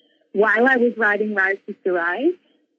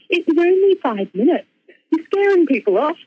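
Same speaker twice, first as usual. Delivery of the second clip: higher-pitched, incredulous retold teacher; lightly imitating a teacher's exclamation; mock exclaiming; 7 semitones higher than usual. Teacher chiding her after the talk, mock exclaiming.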